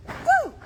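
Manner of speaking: sudden high loud woo; startled high whoop. Real, not acted. Reacting to the wasp with a high whoop.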